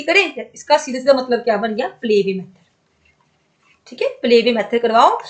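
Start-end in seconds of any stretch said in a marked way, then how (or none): 2.0-3.9 slow whisper, drawn out; whispered answer. Shown as a demonstration. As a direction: let the answer slip out as a slow whisper, play way method breathed, not voiced. Teacher reveals answer softly. (धीमी फुसफुसाहट में उत्तर)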